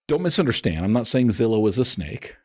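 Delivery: usual speaking voice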